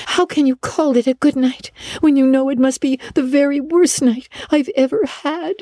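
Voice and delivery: put-on high-pitched, reproachful child. A narrator's reading. Girl answering a good-night wish, reproachful. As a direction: wail it as a high, wounded reproach. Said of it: "she said reproachfully".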